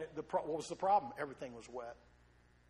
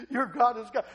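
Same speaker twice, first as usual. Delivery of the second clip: the same words again, now much louder and higher; a crowd proclaiming its new faith out loud; loud acclamation; 4 semitones higher, 8 dB louder than usual. Acted. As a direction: shout it out in triumph.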